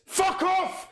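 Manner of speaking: high furious shout; angry outburst. Real, not acted. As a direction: shout him away. Angry at the player.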